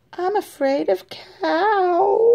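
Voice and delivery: timid chicken dragging the words; scared chicken. Acted. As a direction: stretch out cow like a nervous hen. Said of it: "said one of the chicken".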